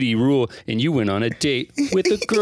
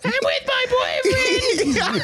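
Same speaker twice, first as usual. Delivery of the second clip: put-on very high, slow squealing girl voice; man doing a woman's voice; much slower than usual. Still voicing Rez.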